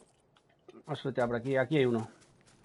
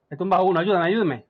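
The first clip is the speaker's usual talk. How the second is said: urgent call for help; 4 semitones higher, 7 dB louder than usual. Real, not acted. Asking teammate for help.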